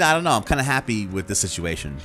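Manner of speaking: normal talking voice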